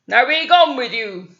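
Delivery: loud dismissive troll bark; troll sends the goat across the bridge, loudly; troll shouting. Acted. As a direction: shoo the goat off with a growl. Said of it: "he shouted".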